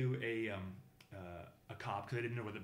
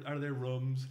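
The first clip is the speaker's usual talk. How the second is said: usual speaking voice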